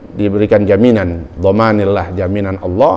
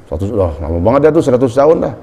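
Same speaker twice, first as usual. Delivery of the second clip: ordinary talk, nothing marked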